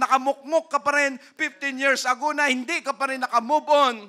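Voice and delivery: loud, high, driving sermon voice; fiery preaching. Acted. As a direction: preach it hard at the congregation. Preaching at full force.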